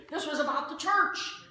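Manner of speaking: ordinary talk, nothing marked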